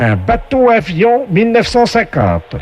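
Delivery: slow, booming quiz-show host; game show announcer voice. Acted. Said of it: "la voix du jeu".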